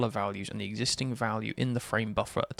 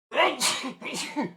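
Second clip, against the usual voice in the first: sudden high real sneeze; sneezing. Real, not acted. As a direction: let a real sneeze burst out. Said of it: "I'm gonna sneeze again".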